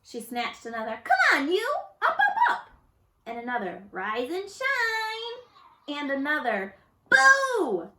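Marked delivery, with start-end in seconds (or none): 1.0-2.7 very high, loud urging; yelling. A narrator's reading. Camilla yelling at leaves. 3.9-6.0 high, very slow call; singsong wake-up. A narrator's reading. Camilla waking a leaf. 7.1-7.9 very high, loud scare; shouted boo. A narrator's reading. Camilla scaring a leaf.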